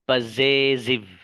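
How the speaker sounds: Possessive drawn out slowly, each syllable clear; slow drill. Shown as a demonstration. Teacher drilling the term for students, very slow. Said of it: "इन सबको कहा क्या जाता है".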